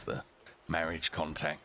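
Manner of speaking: ordinary delivery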